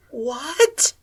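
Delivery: can't believe her ears; incredulous. A narrator's reading. Akira reacting to Sally Ann, incredulous. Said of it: "Akira was incredulous".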